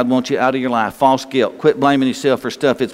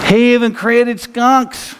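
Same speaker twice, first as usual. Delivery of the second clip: high, slow wonder; 7 semitones higher, slower than usual. Acted.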